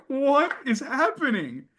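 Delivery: high incredulous question; bewildered. Retold or imitated. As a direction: ask it in complete confusion. Bewildered actor.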